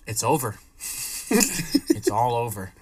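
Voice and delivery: low, ominous mock-prophet delivery; hammed-up low voice of dread; imitating doomed friend. Acts friend's doomed announcement.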